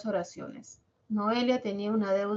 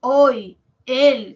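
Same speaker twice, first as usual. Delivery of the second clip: "hoy", "él" as separate loud single beats; one syllable each; 3 semitones higher, 10 dB louder than usual. Shown as a demonstration. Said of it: "se escucha una sola sílaba".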